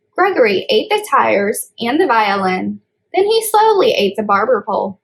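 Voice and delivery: put-on reading a picture book aloud to kids; storybook reading. A narrator's reading. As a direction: read it like story time for little ones. Child reads storybook aloud.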